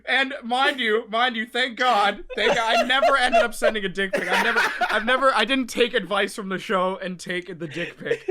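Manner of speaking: real laughter, slowed protest; laughing protest. Real, not acted. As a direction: insist while cracking up. Louder protest through laughter.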